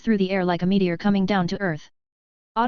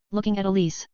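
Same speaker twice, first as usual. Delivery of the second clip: plain reading voice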